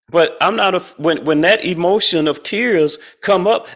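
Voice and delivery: usual speaking voice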